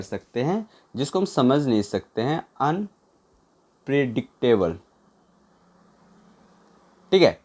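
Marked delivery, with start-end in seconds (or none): 2.6-4.8 unpredictable slow, in separate chunks; slow, broken up. Shown as a demonstration. Teacher dictating a word to write down, unpredictable slowly in pieces.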